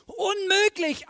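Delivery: loud, high, drawn-out exclamation; high-pitched, incredulous outburst; exclaimed disbelief. Acted. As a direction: blurt it out with a big exclamation mark.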